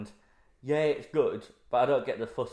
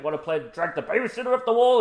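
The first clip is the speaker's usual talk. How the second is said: higher, louder playful villain taunt; mock Freddy Krueger taunt; 7 semitones higher, 6 dB louder than usual. Fan imitating Freddy Krueger taunting, higher and louder. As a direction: ham up a horror villain teasing. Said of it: "And he's like".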